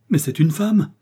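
surprised exclamation. A narrator's reading. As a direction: gasp it out in shock. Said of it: "s'exclama Laurence".

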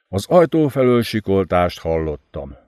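ordinary delivery

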